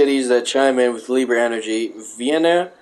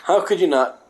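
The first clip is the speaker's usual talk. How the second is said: ordinary delivery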